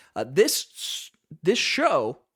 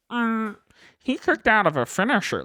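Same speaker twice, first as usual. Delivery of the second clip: mocking whiny fan; 8 semitones higher, 7 dB louder than usual. Mocking complaining fans.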